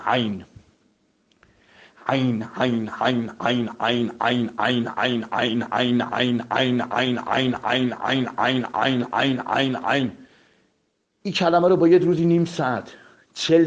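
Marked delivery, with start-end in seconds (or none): 0.0-10.1 عین repeated from the throat, Arabic style; repeated ain drill. Shown as a demonstration.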